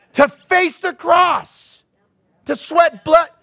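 a rising preacher's cry; preaching climax. Real, not acted. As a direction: hammer it home with full force.

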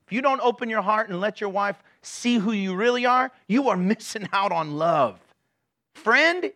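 ordinary talk, nothing marked

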